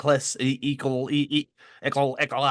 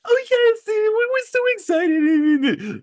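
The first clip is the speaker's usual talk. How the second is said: very high, loud and drawn out; sweet high voice; 7 dB louder, slower than usual. Retold or imitated. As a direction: gush it sweetly, then slowly. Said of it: "I really love how he would be".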